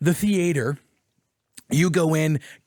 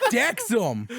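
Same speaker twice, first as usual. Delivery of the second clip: normal talking voice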